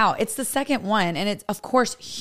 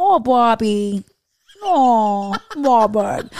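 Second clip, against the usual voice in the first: put-on drawn-out Minnesota mom accent, slow and louder; 5 dB louder, much slower than usual. Podcast host imitating a Minnesota mom from Bobby's World, slow and drawn out. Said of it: "And the mom".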